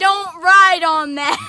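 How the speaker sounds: slow, loud warning yell; loud warning shout. Real, not acted. Telling kid off on sled.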